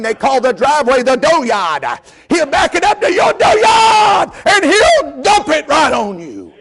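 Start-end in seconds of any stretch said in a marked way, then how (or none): 2.3-4.3 high, loud, slow shout; shouted dooryard, voice thrown high. Real, not acted. Shouted repeat of line 7. 4.5-6.5 high slowed shout hammering the point home. Real, not acted. Preacher shouting repeated threat.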